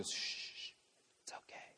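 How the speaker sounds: hushed, quick whispered shushing; soothing whisper. Acted. Parent soothing a crying baby in arms, soothing whisper. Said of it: "You just whisper".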